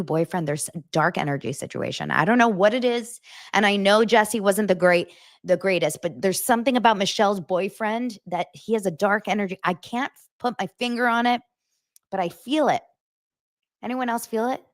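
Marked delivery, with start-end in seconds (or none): none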